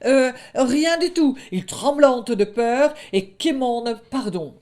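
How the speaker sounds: high, loud nervous fib; lightly voiced fibbing young wolf; lying wolf cub. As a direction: cover up your trouble. Wolf lies to brother.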